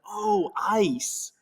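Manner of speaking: high, drawn-out American "ice" of sudden understanding; imitated American accent. Shop lady finally understanding what he wants, American accent. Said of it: "And she's like".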